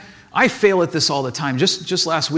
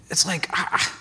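whispered; 6 dB quieter than usual. Real, not acted. Whispered admission.

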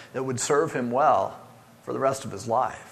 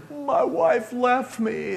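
high, drawn-out mock wail; imitated crying; 9 semitones higher, much slower than usual. Preacher imitating a crying man in counseling, tearful wail. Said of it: "this guy's crying".

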